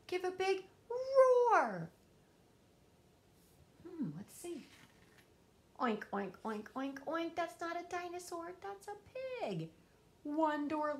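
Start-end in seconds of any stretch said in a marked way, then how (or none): 0.1-1.9 loud, slow and higher, a roar-like call; big drawn-out call. Acted. Asking the hidden dinosaur to roar. 5.8-7.4 pig oinks. Acted. Pig behind the door.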